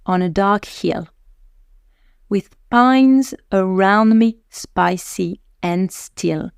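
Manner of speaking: slow, deliberate poem reading in a French accent; French-accented English reading. Shown as a demonstration. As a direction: read the English verse with a strong French accent. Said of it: "avec mon meilleur accent français".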